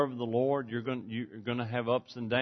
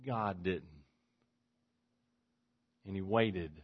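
ordinary delivery

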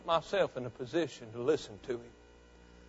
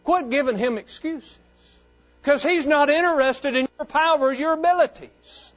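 loud, higher, driving sermon voice; preaching at full force; 6 semitones higher, 6 dB louder than usual. Acted. Pastor urging his congregation to submit, full-force preaching. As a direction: preach it out to the whole church.

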